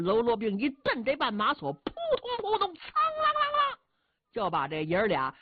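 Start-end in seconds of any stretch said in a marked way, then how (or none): 1.7-3.8 very high sung-out onomatopoeia of thuds and ringing metal; mimicked crash and clang. A narrator's reading. Storyteller imitating riders crashing down, high ringing sound effect.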